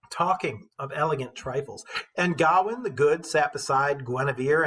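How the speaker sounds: put-on measured storytelling read of an old epic; steady, rhythmic verse narration; poem reading. A narrator's reading. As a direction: read it like a bard to the class. Teacher reads epic poem aloud.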